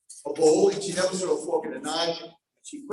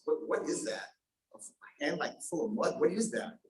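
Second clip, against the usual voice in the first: whispered, puzzled questions; hushed wonder; 2 semitones lower, 9 dB quieter than usual. Acted. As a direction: breathe it out in disbelief. Prince whispers in surprise.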